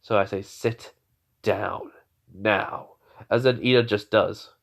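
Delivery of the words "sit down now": a warning, barely above a murmur; cold command to sit down now; quiet menacing order. Retold or imitated. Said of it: "as I'm glaring at him".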